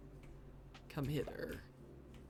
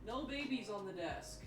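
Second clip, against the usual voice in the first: gentle scolding of the cat; 8 semitones higher, 5 dB quieter than usual. Real, not acted.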